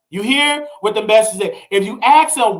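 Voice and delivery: normal talking voice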